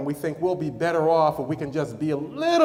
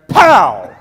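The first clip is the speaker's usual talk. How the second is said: sudden, much louder "pow" like a punch landing; explosive shout; 13 dB louder than usual. Acted. Preacher acting out the crazy man's knockdown blow, explosive shout. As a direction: burst out with a booming impact.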